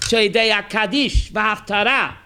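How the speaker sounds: usual speaking voice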